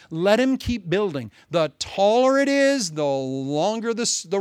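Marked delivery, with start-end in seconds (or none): none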